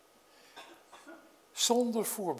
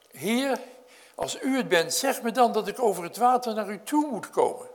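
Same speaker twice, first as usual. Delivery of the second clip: louder, higher eager plea; eager call; 5 semitones higher, 6 dB louder than usual. Acted. Peter calling to Jesus from the boat, eager and loud. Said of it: "die zegt".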